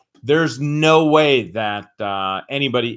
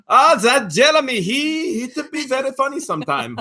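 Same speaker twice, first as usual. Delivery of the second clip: much higher Indian accent, mom impression; 12 semitones higher than usual. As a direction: Indian accent like a doting mother. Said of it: "do her voice in an Indian accent".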